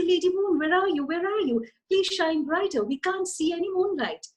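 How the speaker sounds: high, pleading stars calling for the moon; agitated stars. Acted. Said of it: "the stars get very agitated".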